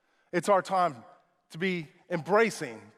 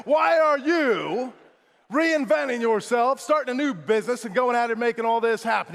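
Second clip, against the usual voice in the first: high, emphatic retold question; amazed questioning; 5 semitones higher than usual. Acting out his amazed question.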